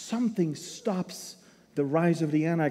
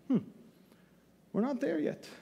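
ordinary talk

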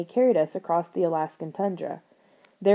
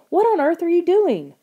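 much higher, loud disbelieving screech; 10 semitones higher, 6 dB louder than usual. A narrator's reading. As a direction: shriek at a brother gone mad. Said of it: "Lauren screeched".

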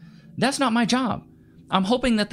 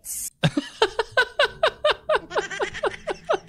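high laugh. Real, not acted. Laughing at clip.

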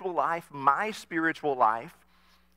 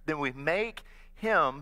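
usual speaking voice

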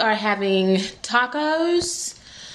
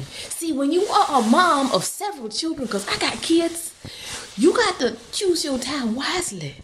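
sassy mom character; 5 semitones higher, 4 dB quieter than usual. Pretend. Mom performing advice in higher voice.

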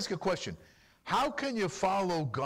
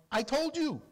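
Yeshua answering; 10 semitones higher, slower than usual. Acted. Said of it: "And Yeshua tells them".

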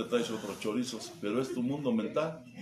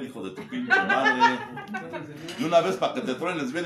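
loud laughing insult; 6 semitones higher, 6 dB louder than usual. Real, not acted. Preacher ribbing the group, loud and laughing. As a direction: bellow the insult through laughter.

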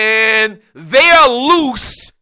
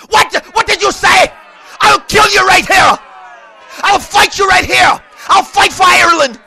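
high, loud mock-furious accented threats; screaming fight fan; 10 semitones higher, 5 dB louder than usual. Irish fan threatening him over Mayweather, screaming imitation. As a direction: bellow like an outraged rowdy fan, hammed up. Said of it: "So they screaming".

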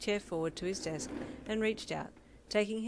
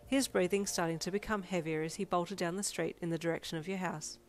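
ordinary delivery, nothing marked

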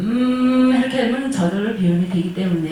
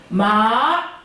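마 rising straight up; rising second tone; 2 semitones higher, 4 dB louder than usual. Shown as a demonstration. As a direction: push 마 up quickly. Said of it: "이성은 어떻게 해요".

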